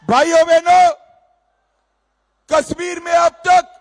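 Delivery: high, loud political oratory; fiery rally speech. Real, not acted. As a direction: thunder at the crowd.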